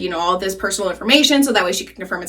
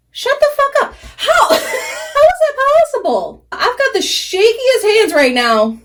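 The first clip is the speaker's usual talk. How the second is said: very high, stretched-out disbelief; incredulous squeal; 13 semitones higher, slower than usual. Real, not acted. Vlogger stunned by her own freehand eyeliner, squealing in disbelief. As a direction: squeal in amazement, dragging the words out.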